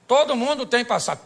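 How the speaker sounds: loud, forceful declaration at a steady pace; emphatic preaching. Real, not acted. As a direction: drive the whole line home to the congregation. Preacher repeats the line with force.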